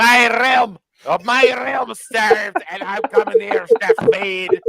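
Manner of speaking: hammed-up Scottish accent, high, loud and wailing; drawn-out mock-tragic wail in a bad Yorkshire accent; Luke, Yorkshire accent turned Scottish. Friend doing Luke's impression of the line, wailed in mock Scottish. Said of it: "here's Luke's impression of it".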